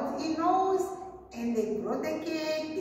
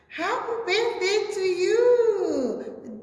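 high, loud cheer; shouted greeting; 7 semitones higher, 5 dB louder than usual. Acted. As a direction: shout it out as a surprise party. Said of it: "came out and shouted".

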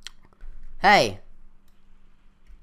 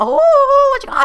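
squeaky high voice; 11 dB louder, much faster than usual. Pretend. Squeaky voice for a mob.

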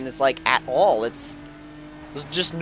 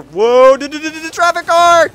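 much higher, louder yell of alarm; startled shout; 12 semitones higher, 7 dB louder than usual. Real, not acted. Gamer yelling as a traffic car cuts in front. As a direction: shout in sudden panic.

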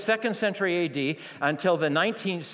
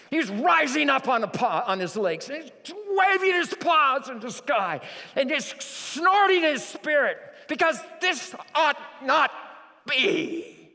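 impassioned shout; 9 semitones higher than usual. Acted. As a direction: preach it with rising fury. Preacher acts out enraged Jesus.

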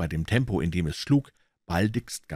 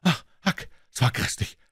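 groaning; 9 semitones higher than usual. Acted. Tom to Huck after escaping, breathless shaken groan. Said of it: "stöhnte er".

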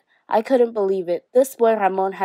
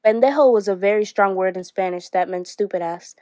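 ordinary delivery, nothing marked

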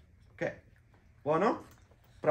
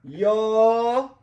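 higher, louder teasing suspense; playful build-up; 10 semitones higher, 9 dB louder than usual. Acted. Father teasing the kids before the answer, high suspense. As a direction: drag out the big reveal.

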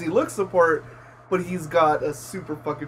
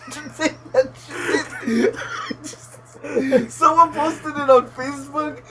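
high-pitched, real laughing talk; 7 semitones higher than usual. Real, not acted. Friend recalling a Photoshop from Facebook, laughing as he talks. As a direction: tell it while cracking up.

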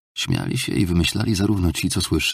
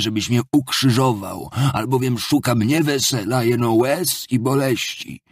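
higher, impassioned self-condemning oration; inspired, anguished Marmeladov figure; 8 semitones higher, 3 dB louder than usual. Acted. Drunkard stands to address innkeeper and judge, in exalted anguish. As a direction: declaim like a martyr begging to be crucified.

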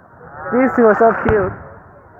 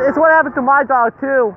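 normal talking voice

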